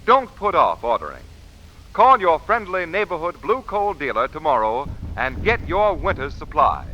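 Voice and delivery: ordinary talk, nothing marked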